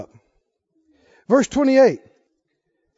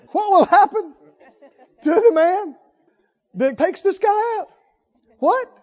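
laughing, eager question; 5 semitones higher than usual. Retold or imitated. David asking with laughter.

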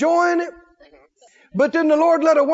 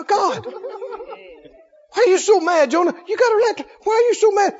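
lightly voiced God, amused; mock God voice with a laugh; 8 semitones higher than usual. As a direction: tease Jonah as God. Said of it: "He's talking to God now".

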